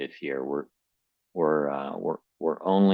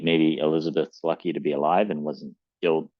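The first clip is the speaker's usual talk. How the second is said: usual speaking voice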